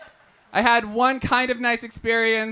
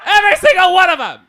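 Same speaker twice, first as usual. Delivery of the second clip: high laughing yell; laughing mock Brit; 8 semitones higher, 12 dB louder, much faster than usual. Comic telling about rude Brits, laughing high yell. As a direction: squeal it through laughter. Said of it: "instead they were like".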